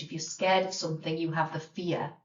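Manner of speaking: normal talking voice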